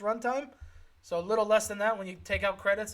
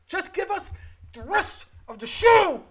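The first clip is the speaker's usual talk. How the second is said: high, loud, slow mock plea; exasperated demand; 11 semitones higher, 7 dB louder, slower than usual. Podcaster begging the network for more episodes, loud exasperated plea.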